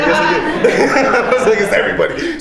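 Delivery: laughing through the words. Real, not acted. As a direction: crack up while admitting it. Laughing while confessing.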